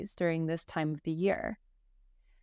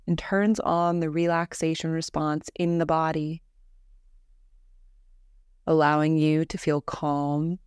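calm, measured meditation voice with long pauses; guided meditation; 3 semitones lower, 5 dB louder than usual. Acted. Teacher leading a listener through Yoga Nidra breathing, guided meditation voice. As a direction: guide them gently into rest, evenly and unhurried.

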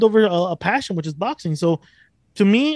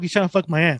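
usual speaking voice

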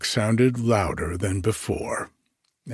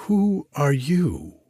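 slow, high astonished question; drawn-out demand to know; surprised cry; 6 semitones higher, slower than usual. A narrator's reading. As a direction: princesses crying out at the stranger, slow and raised. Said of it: "cried the ninety-nine princesses".

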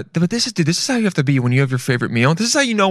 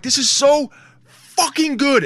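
high, slow emphatic praise; excited exclamation; 10 semitones higher, slower than usual. Retold or imitated. Guy describing his reaction to great food, high and slow. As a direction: savor every word, almost upset. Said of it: "you're like".

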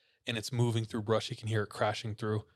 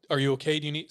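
calling out; 4 semitones higher, 6 dB louder, much faster than usual. Retold or imitated. Calling to moaning voice.

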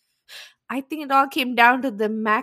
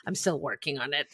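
lower, much softer sulky mutter; low, hushed grudging reply; sulky mumble; 9 semitones lower, 10 dB quieter than usual. Retold or imitated. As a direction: mumble it like a pouting apology. Mocks own sulky line.

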